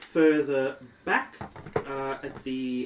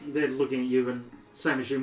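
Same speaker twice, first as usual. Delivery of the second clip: ordinary talk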